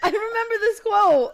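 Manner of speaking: high, loud laughing recall; squealing with laughter. Real, not acted. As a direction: laugh the words out. Laughing recall of a shared quote.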